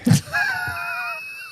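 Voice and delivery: high, squealing burst of laughter. Real, not acted. Engaged host laughing off the attraction joke, high laughter. Joking about being engaged.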